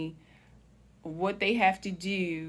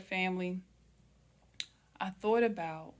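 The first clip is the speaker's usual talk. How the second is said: ordinary talk, nothing marked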